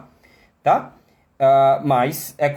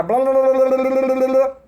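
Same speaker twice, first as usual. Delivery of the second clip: mock student rushing, high and louder; rattling off fast; 9 semitones higher, 4 dB louder than usual. Teacher mocking a student who rushes reading, hurried gabble. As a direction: rattle it off like an eager beginner racing through. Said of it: "e ele dispara".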